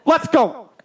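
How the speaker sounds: high, loud doctor urging a push; imitated yelling. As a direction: yell like a doctor in delivery. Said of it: "Doctor Dell's yelling beside her".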